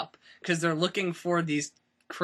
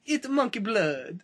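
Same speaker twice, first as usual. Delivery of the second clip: slow, higher, bad foreign accent; Jon Voight; 5 semitones higher, slower than usual. Repeating the film villain's line in a bad foreign accent. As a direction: draw it out in a hammy foreign movie accent. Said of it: "'cause he has this accent".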